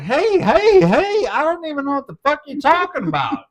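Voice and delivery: loud, high-pitched hey-hey-hey; retold defensive protest. Retold protest to pissed-off partner.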